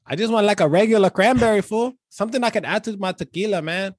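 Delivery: put-on laid-back Chicano street accent, every line capped with fool.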